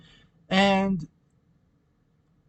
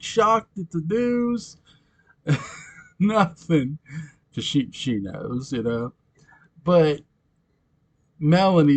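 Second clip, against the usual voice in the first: ordinary talk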